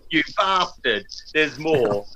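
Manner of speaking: lightly voiced film-star impression; a hint of the actor's film delivery; Michael Caine. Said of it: "just like Michael Caine".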